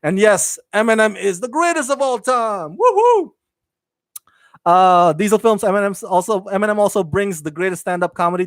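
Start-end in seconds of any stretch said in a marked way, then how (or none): 2.8-3.3 high-pitched woohoo; high, drawn-out cheering whoop; excited cheer. Real, not acted. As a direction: whoop it up in celebration. Celebrates his favorite rapper.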